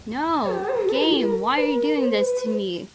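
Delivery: ordinary delivery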